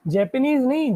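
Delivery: usual speaking voice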